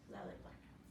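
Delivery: ordinary delivery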